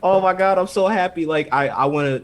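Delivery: hammed-up happy girl; mock overjoyed. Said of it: "And then she's like".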